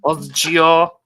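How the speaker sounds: Quebecois stripper voice, put on; French Canadian accent. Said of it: "I have to do the voice".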